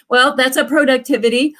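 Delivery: usual speaking voice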